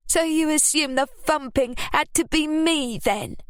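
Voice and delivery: high, sulky and petulant. Acted. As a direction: whine it like a sulky child. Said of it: "Ruby asked petulantly".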